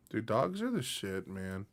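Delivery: normal talking voice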